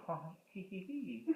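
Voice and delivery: soft acted giggle; small, hushed tee-hee; giggly villain. A narrator's reading. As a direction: giggle quietly, like a sneaky villain. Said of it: "the voice giggled".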